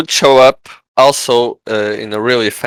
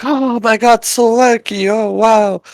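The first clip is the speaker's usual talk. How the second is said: amazed crowd; 11 semitones higher than usual. Retold or imitated. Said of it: "They're like".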